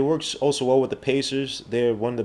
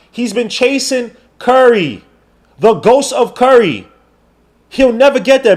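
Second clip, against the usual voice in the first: high, loud heated rant; 11 semitones higher, 7 dB louder than usual. Real, not acted. Streamer heatedly insisting Dame is chasing Curry, high and loud. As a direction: hammer it home to the chat.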